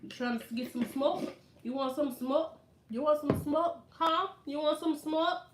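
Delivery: rising, insistent Jamaican sales pitch; Jamaican vendor. Retold or imitated. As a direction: keep pressing the tourist in a Jamaican lilt. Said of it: "they're like".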